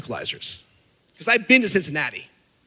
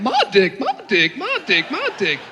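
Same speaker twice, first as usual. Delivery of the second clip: put-on Southern toddler accent; slower than usual. Toddler walking around town announcing himself, Southern accent. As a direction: imitate a toddler's Southern twang, swooping on the last words. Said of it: "he had a Southern accent".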